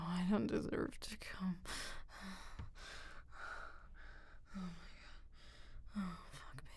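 soft, very quiet whisper. Real, not acted. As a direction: barely whisper it.

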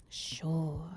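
breathy skeptical whisper; teasing hushed sure; whispered tease. Acted. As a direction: whisper it like you don't believe them. Teasing, mock-doubting reply.

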